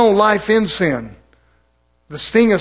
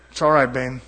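low, softer soothing voice; tender reassurance; 7 semitones lower, 7 dB quieter than usual. Acted. Husband consoles wife who is having a bad day, tender. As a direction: gently comfort a spouse, voice dropped.

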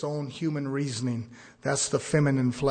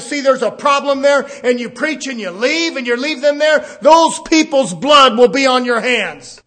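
rising to a loud, high preacher's shout; fiery preaching; 10 semitones higher, 14 dB louder than usual. Acted. Preacher warning the congregation, loud and fervent. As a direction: drive the warning home from the pulpit.

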